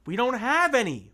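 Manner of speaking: high, loud desperate cry. Acted. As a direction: shout it in panic at the villain. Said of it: "Violet cried".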